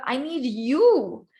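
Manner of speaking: YOU stretched and emphatic; slow finish on a long YOU; stress on you. Shown as a demonstration. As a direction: contrast it by landing hard on YOU. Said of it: "what [name] is highlighting is".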